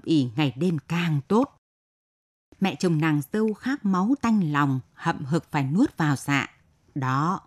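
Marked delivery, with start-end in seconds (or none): none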